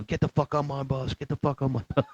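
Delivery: high, strained mock drill-instructor yell; mock screaming. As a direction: imitate a drill instructor screaming without full volume. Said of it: "screaming, you know".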